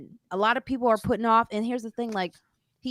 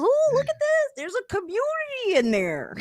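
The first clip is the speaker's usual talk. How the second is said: very high, squeaky mock voice of him marvelling; high-pitched mock wonder; mocking quote. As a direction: squeal it out like an amazed cartoon. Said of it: "He goes, he goes".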